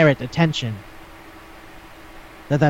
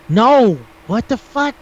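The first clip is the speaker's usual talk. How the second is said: shocked no; 7 semitones higher, 4 dB louder, slower than usual. Retold or imitated. Retold shocked reaction in anecdote.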